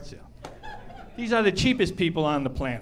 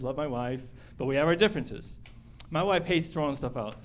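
usual speaking voice

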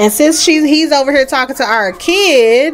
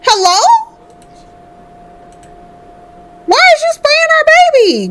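much higher, fast indignant outcry; outraged. Real, not acted. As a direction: yell in protective outrage. Stranger sprays the baby.

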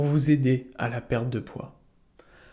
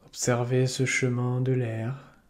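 calm yoga nidra guide, unhurried with long pauses; soothing meditation voice; guided meditation. Acted. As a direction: lead listeners gently into relaxation, leaving space between phrases.

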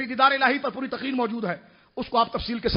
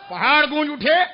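loud, raised, ringing call; 4 semitones higher, 7 dB louder than usual. Real, not acted. Preacher rousing listeners with the echoing mountains, loud. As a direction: lift it high for the crowd. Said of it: "اتنے زور سے اللہ اکبر کہا".

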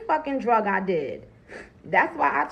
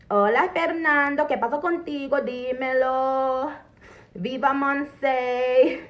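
higher, drawn-out mock greeting in Spanish; playful Spanish hailing; 4 semitones higher than usual. Joking she would hail a dealer, playful drawn-out Spanish call. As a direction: call out to an imaginary amigo, stretching the endings.

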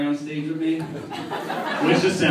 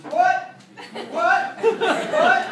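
confused deaf posse; much slower than usual. Retold or imitated. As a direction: ask over and over, not hearing. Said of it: "they're like".

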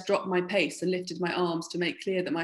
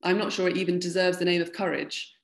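ordinary delivery, nothing marked

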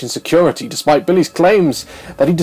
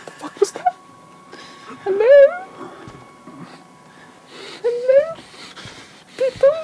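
much higher, scared calls; nervous calling out. Real, not acted. Player calling into a dark room, high nervous calls.

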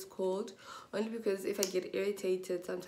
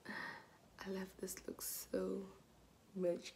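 whisper; 8 dB quieter, much slower than usual. Real, not acted. Whispered gush over outfit.

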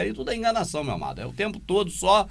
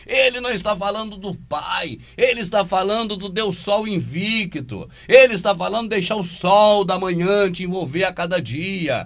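loud, high, insistent denunciation; emphatic rebuttal; 5 semitones higher, 7 dB louder than usual. Real, not acted. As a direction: raise the voice and drive the point home, stretching the last words. Heated rebuttal of the played preacher.

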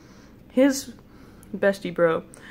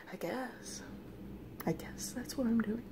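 very soft, uncertain murmur; sheepish; 2 semitones higher, 13 dB quieter than usual. Retold or imitated. Dreamer answering a friend at the dark doorway, sheepish murmur. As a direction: mumble it, half whispered.

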